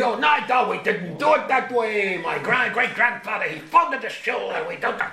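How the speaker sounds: put-on grumpy old man. Grumpy shul elder objecting that things weren't done that way, grumbling. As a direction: protest like the shul's grumpy old-timer.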